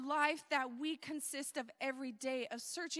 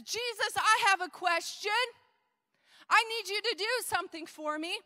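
higher, louder pushy questioner; demanding man in crowd; 5 semitones higher, 6 dB louder, faster than usual. Acted. Man stands up demanding a favour.

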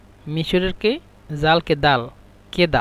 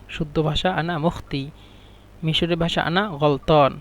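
ordinary talk, nothing marked

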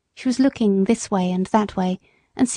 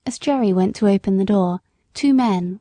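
ordinary delivery

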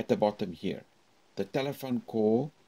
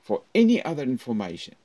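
faster, louder, raised "For any other information"; 3 semitones higher, 7 dB louder, faster than usual. Shown as a demonstration. Teacher repeating with a raised voice. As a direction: push it up again. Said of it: "Up, up, up".

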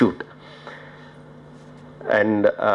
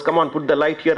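mild mock shout, higher in pitch; retold shouting; 6 semitones higher than usual. New director calling to the light boys, mock shouting. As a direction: lightly imitate shouting orders, pitch raised. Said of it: "I started shouting".

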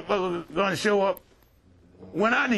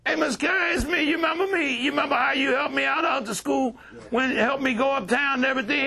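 higher, insistent voice of recognition; eager greeting; 7 semitones higher than usual. Acted. Youth to the man who helped him, eager greeting. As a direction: press him eagerly to remember you.

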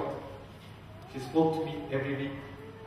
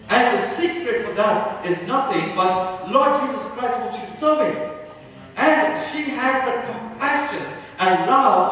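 loud, high-pitched sermon delivery; raised, emphatic preacher's voice; impassioned preaching; 7 semitones higher, 6 dB louder than usual. Real, not acted. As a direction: preach it out with full conviction. Preaches at full force.

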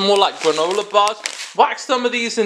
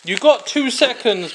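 ordinary talk

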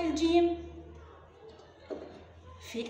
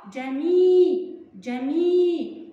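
جمي stretched very slowly and said louder; drawn-out syllables; 7 dB louder, much slower than usual. Shown as a demonstration. Teacher sounds out the leftover syllables loudly for children. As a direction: hold جمي long, as a syllable drill. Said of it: "ماذا يبقى؟".

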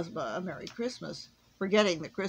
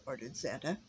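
ordinary delivery, nothing marked

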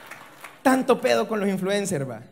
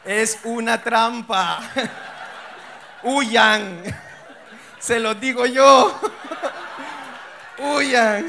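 dramatic warning; 6 dB louder, much slower than usual. Acted. Advertencia dramática al público.

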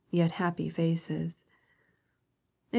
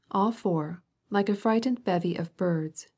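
ordinary delivery

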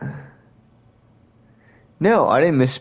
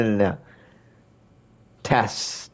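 usual speaking voice

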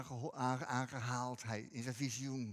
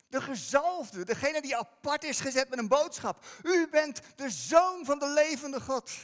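preaching at full force; 11 semitones higher, 11 dB louder than usual. Acted. Preacher telling the church no one is like Jesus, loud proclamation. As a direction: proclaim it with full conviction to the congregation.

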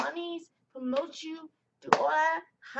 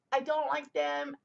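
normal talking voice

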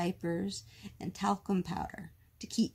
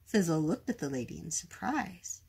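ordinary delivery, nothing marked

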